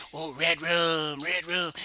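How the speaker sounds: slow, lightly spooky movie voice; a hint of the haunted boy from the film; creepy Shining quote. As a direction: drag out the creepy words. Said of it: "the time co-, goes".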